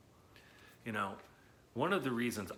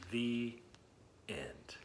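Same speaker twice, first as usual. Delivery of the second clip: trailing off to a whisper on end; soft story ending; 3 semitones lower, 6 dB quieter than usual. Acted. Teacher closing the book for children, whispered. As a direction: let the last word fade into a whisper.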